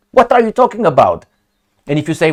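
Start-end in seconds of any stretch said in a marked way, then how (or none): 0.1-1.3 indignant. Real, not acted. Indignant outburst.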